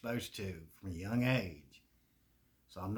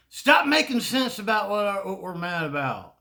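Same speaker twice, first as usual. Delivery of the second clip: mock indignant; 8 semitones higher, 10 dB louder than usual.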